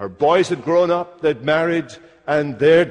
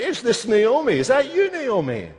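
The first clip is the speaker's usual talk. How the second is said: a hint of amazed townsfolk, higher pitched; townspeople asking; 7 semitones higher than usual. Bethlehem townsfolk ask Naomi if it's her, lightly voiced. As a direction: townsfolk wonder aloud, voice lifting high. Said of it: "They're looking at Naomi and they say".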